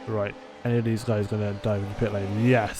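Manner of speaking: ordinary delivery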